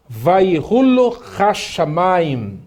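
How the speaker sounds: slow, drawn-out Hebrew phrase; slow model reading. Shown as a demonstration. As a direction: read it out slowly for students to follow. Teacher models the Hebrew phrase slowly. (leitura lenta e alongada, como modelo)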